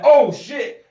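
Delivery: sudden loud startled curse. Retold or imitated. Friend retelling the coworker's belly rub, startled. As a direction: blurt it out, caught off guard.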